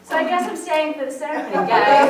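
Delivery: loud, high, jubilant declaration; excited announcement. Real, not acted. Speaker tells the group she's staying for the ceremony, excited. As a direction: announce it loudly, building to a joyful shout.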